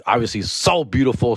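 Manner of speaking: ordinary talk, nothing marked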